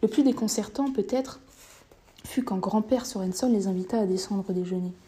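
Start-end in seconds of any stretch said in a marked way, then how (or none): none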